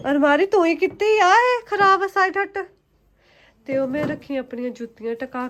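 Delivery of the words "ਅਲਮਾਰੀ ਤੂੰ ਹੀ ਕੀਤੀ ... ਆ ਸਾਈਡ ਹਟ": high scolding. Real, not acted. Mother scolds toddler at cupboard. (ਉੱਚੀ ਆਵਾਜ਼ ਵਿੱਚ ਝਿੜਕਣਾ)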